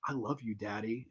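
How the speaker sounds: put-on breathy little-girl coo. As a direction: say it hushed and sweet. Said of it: "she's like".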